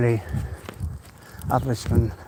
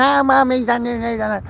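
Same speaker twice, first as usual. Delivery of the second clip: put-on high, whiny kid voice begging mum; whiny child; 9 semitones higher than usual. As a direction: play the squabbling grandkid pleading with mummy. Kids bickering voiced in story.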